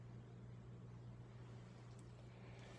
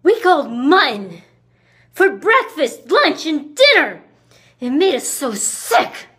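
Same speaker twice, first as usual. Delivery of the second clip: loud, high and emphatic; indignant, hammering every phrase; disgusted complaint; 5 semitones higher, 8 dB louder than usual. Acted. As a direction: punch out each item in exasperation. Complaining about the food.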